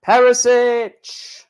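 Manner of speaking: mock announcer calling the name; drawn-out name call. Calling out drawn player names.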